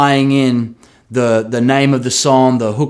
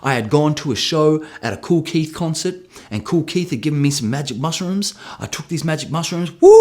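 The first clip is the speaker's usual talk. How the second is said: ordinary talk, nothing marked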